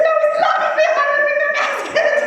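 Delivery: very high, fast, laughing protest. Retold or imitated. Scared of the spider, laughing while correcting her friend. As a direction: squeal it through laughter.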